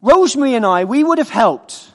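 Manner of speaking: lightly voiced wounded neighbour insisting; the neighbour lightly played, offended he wasn't asked; a hint of the hurt neighbour. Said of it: "and he said".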